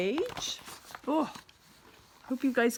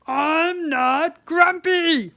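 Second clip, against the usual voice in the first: slow, much louder grumpy shout; 5 semitones higher, 8 dB louder, slower than usual. Acted. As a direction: bellow it in a sulky temper. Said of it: "sorry for shouting at everyone".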